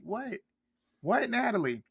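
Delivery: indignant high what; incredulous what. Real, not acted. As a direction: call her out in disbelief. Ranting at Natalie on screen.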